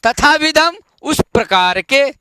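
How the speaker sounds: ordinary talk, nothing marked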